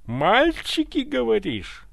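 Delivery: high, loud incredulous shout; outraged yell. A narrator's reading. Ivan rounds on his butler, outraged yell. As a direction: throw the word back at him, yelling. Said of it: "заорал Иван".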